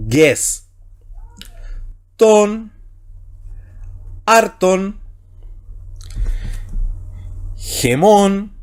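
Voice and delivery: slow, separated Greek words; slow syllable-by-syllable Greek; slow Greek reading. Shown as a demonstration. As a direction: give each Greek word its own pause. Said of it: "Más lentito ahora".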